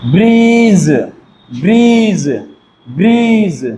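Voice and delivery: Bridge called out loud, ending ज; loud Bridge drill. Shown as a demonstration. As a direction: call bridge out to the class. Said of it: "क्या लिख रहे हैं हम लोग?". (Bridge को ज़ोर से बोलकर)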